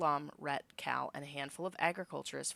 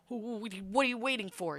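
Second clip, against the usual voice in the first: stammering fear; 6 semitones higher than usual. Acted. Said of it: "quivers with fear".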